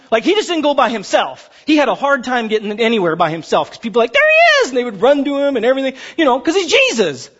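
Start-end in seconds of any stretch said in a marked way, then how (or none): 4.1-4.7 high-pitched excited call; excited crowd. Acted. People spotting Jesus in the street, excited call. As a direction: cry out on spotting a celebrity.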